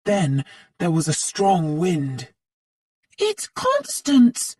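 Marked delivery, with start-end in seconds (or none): 3.2-4.6 high, slow startled cry; a girl crying out in fear to her friends; frightened cry. A narrator's reading. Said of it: "cried Jenny".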